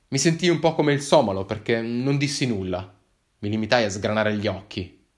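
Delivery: plain reading voice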